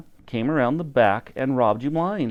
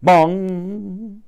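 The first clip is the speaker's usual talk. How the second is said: clock bong; 6 semitones higher than usual. A narrator's reading. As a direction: ring out like a big clock bell. Clock bell sound effect.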